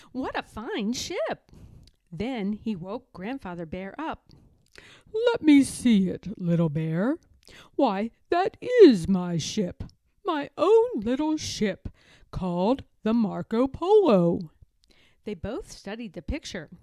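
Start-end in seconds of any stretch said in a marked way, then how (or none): 0.1-1.5 excited. Acted. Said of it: "cried Little Bear". 5.1-14.5 put-on louder fond old grandfather; delighted grandpa voice. Grandfather recognizes his ship.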